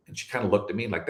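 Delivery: usual speaking voice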